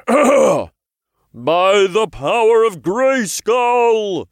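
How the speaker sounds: loud, drawn-out incantation; slow, grandiose imp battle cry; booming heroic voice. A narrator's reading. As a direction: proclaim it like a cartoon hero. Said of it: "the imp said in a deep voice".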